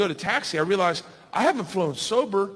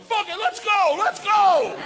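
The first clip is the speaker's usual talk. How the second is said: high, pumped-up shout; re-enacted rowdy rallying cry; reckless battle cry; 11 semitones higher, 4 dB louder, slower than usual. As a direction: yell it with beer-fuelled bravado. Drunk bravado acted out.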